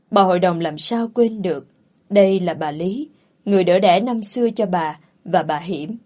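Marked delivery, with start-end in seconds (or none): none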